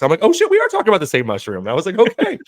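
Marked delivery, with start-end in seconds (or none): 0.2-1.6 high, loud and fast burst; mock excited realization. Guy learning they mean psychedelic mushrooms, acted excited realization. Acts out his sudden realization. 2.0-2.4 very high, loud okay; mock eager agreement. Guy agreeing to psychedelics talk, acted high eager okay. Acts out his eager reply.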